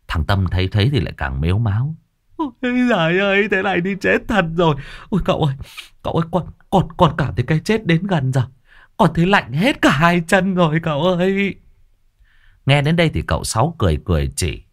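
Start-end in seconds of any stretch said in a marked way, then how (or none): 2.4-11.5 high melodramatic whine; whining dying lad. Acted. Tâm wails to uncle he feels death coming, high whine. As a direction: moan like death is near, pitched high.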